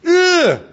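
high, loud pained ugh; goat's groan. Pretend. Goat groans as its flesh is burned out, high pained cry. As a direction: let out a disgusted cry of pain.

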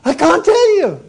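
mother straining to keep the miracle secret; bursting mother. Acted. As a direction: hold back a secret you can barely keep. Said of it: "And she says".

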